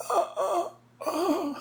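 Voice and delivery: high, strained winded groans; pained groans. Retold or imitated. Crushed wrestler groans back at his partner. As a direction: squeeze out groans with broken ribs. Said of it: "And I'm just like".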